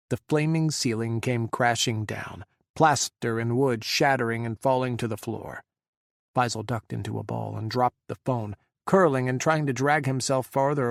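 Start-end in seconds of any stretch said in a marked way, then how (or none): none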